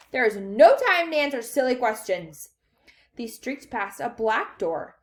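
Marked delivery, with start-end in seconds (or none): 0.1-2.5 loud brush-off; impatient shout. A narrator's reading. Wonka refusing the children's questions, shouting. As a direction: dismiss it at a shout. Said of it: "shouted Mister Wonka".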